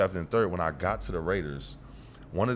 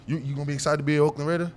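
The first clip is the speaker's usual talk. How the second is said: quick, higher imitation of the caller; team caller; 6 semitones higher, faster than usual. Team asking its new pick, imitated caller voice. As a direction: pitch it up and hurry, as the recruiter. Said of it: "I get a call".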